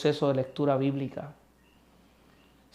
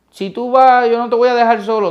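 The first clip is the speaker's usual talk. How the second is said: higher, louder and fast; bold boast; 8 semitones higher, 10 dB louder than usual. Acted. Brash Peter swearing never to leave Christ, loud and fast. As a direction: blurt the promise in a rush.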